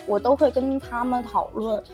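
usual speaking voice

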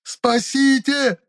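very high, long-drawn scream; high, slow, loud wail; full-throated cry for help. A narrator's reading. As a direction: stretch out a desperate call for rescue. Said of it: "во всё горло закричал оловеец".

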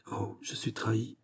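soft, hushed murmur of dismay; hushed and crushed. A narrator's reading. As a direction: let it slip out softly, defeated. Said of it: "murmura-t-il".

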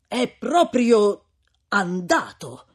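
slow, drawn-out amazed exclamation. A narrator's reading. Priest marvelling that the tyrant is dead, drawn out. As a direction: let the news sink in, marvelling. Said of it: "esclamò Don Abbondio".

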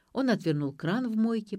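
ordinary delivery

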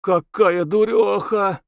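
high, long-stretched exclamation; drawn-out. A narrator's reading. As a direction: stretch every vowel in disbelief. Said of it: "она протяжно сказала".

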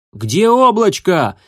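high, loud, slow shout. A narrator's reading. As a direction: yell across the snow. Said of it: "кричал он".

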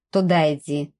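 low curt order, teeth clenched; through gritted teeth. A narrator's reading. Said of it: "процедила сквозь зубы".

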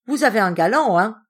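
loud, high accusing question; sudden fury. A narrator's reading. Mistress accusing the servant of having a lover, furiously. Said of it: "suffoquée par cette fureur soudaine".

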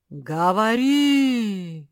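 slow, drawn-out booming command; the lake speaks, slow and grand. A narrator's reading. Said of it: "велело озеро".

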